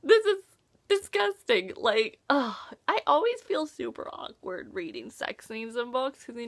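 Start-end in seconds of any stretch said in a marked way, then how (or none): 0.0-1.7 mock disgusted exclamation, higher and louder; teen reader acting grossed out by a book. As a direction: recoil in playful disgust at the page. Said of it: "I was just like".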